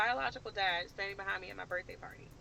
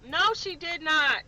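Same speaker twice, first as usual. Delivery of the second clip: louder, higher, slow no she did not; firm, raised rebuttal; emphatic denial; 7 semitones higher, 8 dB louder, slower than usual. Real, not acted. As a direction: flatly shut it down, word by word. Snaps back at imagined viewers.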